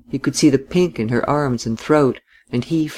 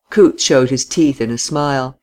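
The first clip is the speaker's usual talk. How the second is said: usual speaking voice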